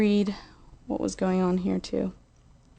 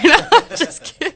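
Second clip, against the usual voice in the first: laughing; 13 semitones higher, slower than usual. Real, not acted. Joking about being stoned, laughing.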